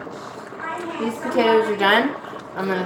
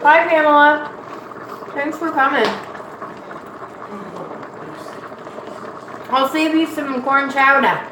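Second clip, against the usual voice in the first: excited greeting; 4 semitones higher than usual. Real, not acted. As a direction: greet the viewers brightly. Livestream greeting viewers loudly.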